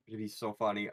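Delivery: low, soft murmur to yourself; quiet musing. Real, not acted. Player weighing the paid gig, quietly tempted. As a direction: let the tempting thought slip out softly.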